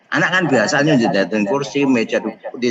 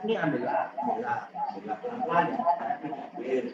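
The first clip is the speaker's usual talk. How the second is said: put-on much softer, calm coaxing to the child; gentle mother; 10 dB quieter than usual. Mother calmly helps her child pick up the broken glass, much softer. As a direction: soothe the child, softly.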